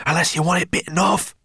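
put-on high, fast girl's voice; a scared girl warning; frightened girl. A narrator's reading. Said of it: "whispered Sorrel".

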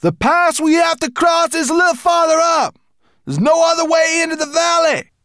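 very high, loud shout over wind; called back. A narrator's reading. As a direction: shout directions across the wind. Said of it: "Berber Chan called back".

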